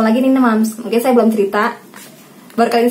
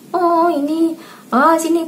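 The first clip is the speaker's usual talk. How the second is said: put-on higher-pitched curious little child; meniru anak; 7 semitones higher, slower than usual. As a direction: lightly put on a child's high voice pointing things out. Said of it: "anak saya yang tadi lari". (menirukan suara anak kecil, nada tinggi)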